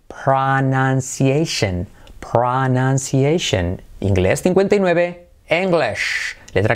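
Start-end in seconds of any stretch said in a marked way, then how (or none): 0.1-3.8 pronunciation said very slowly, each syllable drawn out; slow demo. Shown as a demonstration. Slow model word for Spanish learners. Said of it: "pronunciación".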